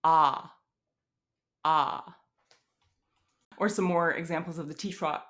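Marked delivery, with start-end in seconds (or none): 0.0-2.2 "Ah" with the jaw wide open; "Ah" as a low front unrounded vowel; low front vowel demo. Shown as a demonstration. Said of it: "And low front unrounded vowel".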